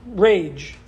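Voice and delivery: ordinary talk, nothing marked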